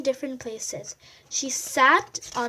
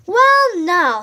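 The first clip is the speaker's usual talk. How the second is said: high, loud musing well now; Porcupine voice; 5 semitones higher, 12 dB louder than usual. A narrator's reading. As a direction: draw out the thinking high and loud. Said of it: "said Porcupine".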